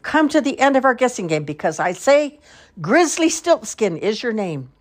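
high, declaimed play line; clear, loud voice. A narrator's reading. Sister as princess guessing the elf's name, clear and loud. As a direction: proclaim it triumphantly, slowing on the name. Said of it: "in a clear, loud voice".

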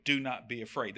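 soft, low reassurance; gentle, hushed command; calm speaking. Acted. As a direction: quietly settle their fear. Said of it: "He just speaks to them".

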